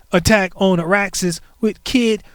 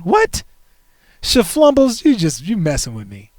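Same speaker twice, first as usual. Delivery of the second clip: shocked what. Real, not acted. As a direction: jolt up in real shock. News apartment burned down.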